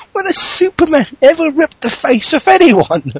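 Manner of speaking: ordinary talk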